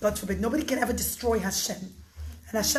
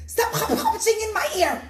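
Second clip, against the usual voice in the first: loud, high angry yell with a laugh; furious king in the story; screaming king; 9 semitones higher, 6 dB louder than usual. Acted. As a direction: yell it out in frustration.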